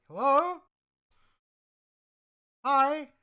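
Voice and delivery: high, loud hello with rising lilt; bright-pitched, projected villager hail; calling out. Acted. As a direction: call out a greeting to strangers. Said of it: "the woman is just gonna, like, call out".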